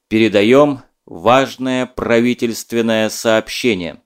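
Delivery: slow, loud, stern and anxious radio announcement; stern grave announcer. Acted. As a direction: announce grave news, severe and alarmed. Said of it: "звучал он как-то необычно строго, сурово и тревожно". (строго, сурово и тревожно, как диктор)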